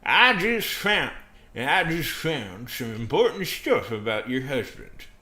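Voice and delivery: loud, slow, high character voice; dead serious America. Acted. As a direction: announce grave news with heavy drama. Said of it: "dead serious".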